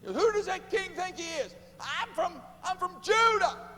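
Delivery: loud, high mock indignation; indignant boast. Preacher voicing the arrogant captive, loud mock outrage. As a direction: puff up like an offended captive. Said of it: "you and I have never talked like that".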